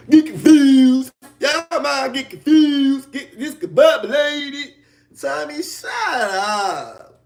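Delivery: high, drawn-out mimicry of muddled speech; mocking garbled talk. Streamer mocking a rival who can't talk, garbled mimicry. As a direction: stretch the words out high, mocking how Tommy talks. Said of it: "talking like this and doing it like that".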